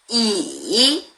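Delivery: Mandarin third tone on 이. Shown as a demonstration. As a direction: scoop 이 down and back up. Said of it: "한번 사성으로 해보겠습니다".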